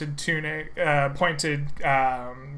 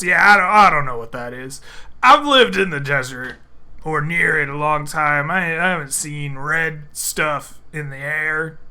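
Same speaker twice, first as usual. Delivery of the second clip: loud, rambling, fast orc barkeep; an out-of-his-depth orc blustering through; flustered orc barman; 5 dB louder than usual. Acted.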